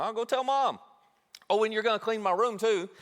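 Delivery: high, sing-song gloat; tattletale threat. Retold or imitated. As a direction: taunt him with telling Mom. Younger sibling threatening.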